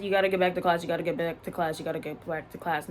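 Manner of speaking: emotionless repeat. Retold or imitated. Staff member brushing off a crying student, cold repeat. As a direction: rattle it off like she doesn't care. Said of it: "No emotion, no concern, no remorse".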